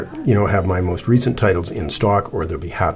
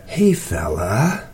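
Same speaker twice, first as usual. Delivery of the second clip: slow, drawn-out coo to a dog; soothing; much slower than usual. A narrator's reading. Cece to the growling dog, soothing. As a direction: soothe a wary animal, stretching the words. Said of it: "Cece said in a soothing voice".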